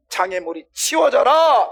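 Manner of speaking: loud, high, slow shouted order; stern command. Acted. Preacher explains Jesus' order by shouting it, stern command. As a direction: order it cleared away, drawing the words out. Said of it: "얘를 꾸짖는 거야".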